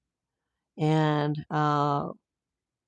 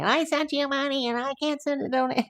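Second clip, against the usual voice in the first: mock complaint; 7 semitones higher than usual. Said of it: "people were yelling, saying".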